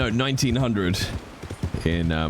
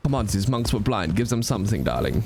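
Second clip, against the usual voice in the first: put-on German accent; 4 semitones higher than usual. Franz selling monk table.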